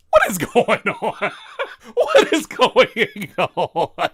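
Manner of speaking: What is going on drawn out with laughter; laughing disbelief. Real, not acted. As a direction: laugh while asking in disbelief. Laughing at the battle verse.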